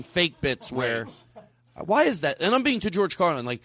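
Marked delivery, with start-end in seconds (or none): none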